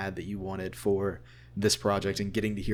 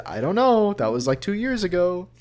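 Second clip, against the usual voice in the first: mock-innocent, much higher and louder; coy teasing; 9 semitones higher, 6 dB louder than usual. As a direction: dodge the question with playful evasion. Keeps teasing wife.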